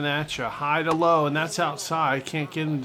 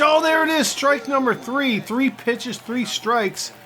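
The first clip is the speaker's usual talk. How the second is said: much higher, louder triumphant yell; excited strikeout call; 8 semitones higher, 5 dB louder than usual. Real, not acted. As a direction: shout it out as the strike lands.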